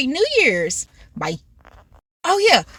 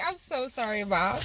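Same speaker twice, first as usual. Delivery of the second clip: much softer, small apology; meek apology; 2 semitones lower, 13 dB quieter than usual. Retold or imitated. Daughter apologizing to mom after the cussing, meek. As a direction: drop to a hushed, sheepish sorry.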